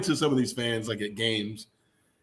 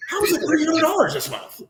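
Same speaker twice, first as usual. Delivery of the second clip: much higher, laughing mock outrage; 3 dB louder than usual. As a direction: squeal about the bill in disbelief. Mock rant over electric bill.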